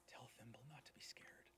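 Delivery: soft, tender whisper in the mind; mental whisper. Acted. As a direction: whisper it as a last comfort. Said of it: "in his mind says".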